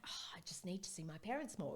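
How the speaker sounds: hushed whisper of longing; a soft whispered wish to see her parents. Retold or imitated. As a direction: whisper it as if to yourself.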